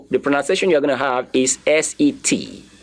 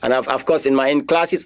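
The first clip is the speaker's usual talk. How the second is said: normal talking voice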